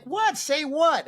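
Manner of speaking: high-pitched, incredulous yelp, retold; bewildered. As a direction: squeal it in mock bewilderment. His bewildered reply, acted.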